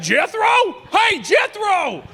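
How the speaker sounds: high, loud hillbilly holler across the airport; calling out to an old friend. Pretend. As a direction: shout his name in happy surprise. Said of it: "they were gonna be like".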